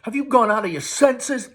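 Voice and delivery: angry mother. A narrator's reading. Mother and son quarrel.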